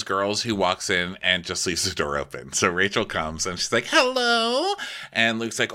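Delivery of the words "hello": high, drawn-out hello; mock greeting call. Rachel's entrance greeting acted.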